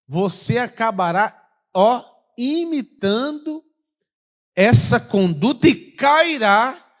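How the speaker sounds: loud, slow, high preaching; preaching at full force. Acted. Pastor warns congregation of deadly trap, loud and slow. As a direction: drive the warning home.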